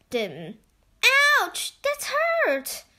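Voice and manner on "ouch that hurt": high, slow whiny ouch; a hurt little boy, pitched way up; Chip in pain. A narrator's reading. Chip pinched by goblins, high pained whine. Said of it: "said Chip".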